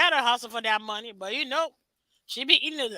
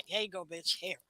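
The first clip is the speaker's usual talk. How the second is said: ordinary talk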